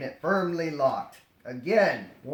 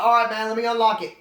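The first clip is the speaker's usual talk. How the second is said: quick casual; 6 semitones higher, 7 dB louder, faster than usual. Retold or imitated. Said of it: "It's like".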